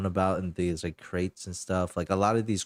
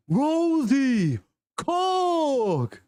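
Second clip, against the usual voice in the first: very high, loud overjoyed cry of names; joyful shout; 5 dB louder, much slower than usual. Acted. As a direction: yell their names in delighted disbelief. Albert sees lost friends.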